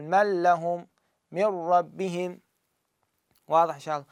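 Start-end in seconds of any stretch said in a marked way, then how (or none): 0.0-2.4 من لهم as مِلَّهُم, من ربهم as مِرَّبِّهِم, slow; tajweed demo. Shown as a demonstration. Teacher repeating the examples slowly. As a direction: merge the نون fully, doubling ل and ر. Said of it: "هنا أقول".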